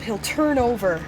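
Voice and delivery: normal talking voice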